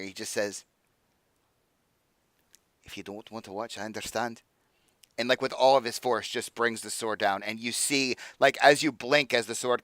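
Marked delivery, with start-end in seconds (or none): 2.8-4.4 quiet aside. Acted. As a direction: say it just loud enough for her to hear. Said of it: "he just quietly says, just loud enough that you can hear".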